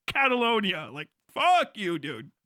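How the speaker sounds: usual speaking voice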